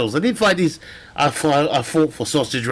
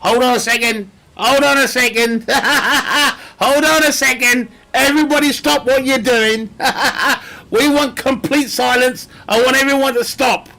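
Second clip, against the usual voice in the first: laughing mock outrage; 9 semitones higher, 3 dB louder than usual. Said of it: "And then you go".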